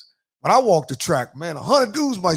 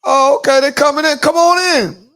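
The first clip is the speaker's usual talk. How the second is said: loud, high-pitched holler; big raised-voice welcome; calling out loudly; 9 semitones higher, 11 dB louder than usual. Real, not acted. Mock welcome shouted out.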